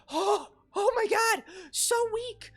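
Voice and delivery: teasing a friend, hammed-up swoon at his voice; mock swooning fan. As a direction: overdo a fangirl going weak at the knees.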